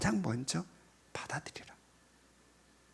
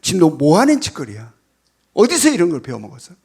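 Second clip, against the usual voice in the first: loud, fast angry scolding; scolding parent; 12 dB louder than usual. Retold or imitated. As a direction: yell at the child as an angry parent. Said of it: "이렇게 하지 말라". (아이에게 버럭 소리치는 부모)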